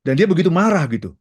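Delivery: ordinary talk, nothing marked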